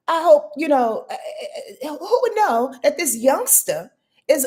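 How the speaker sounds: fighting back tears mid-sentence; real tears welling up; choked up. Real, not acted. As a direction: voice catching with tears. Emotional moment recalling young fan.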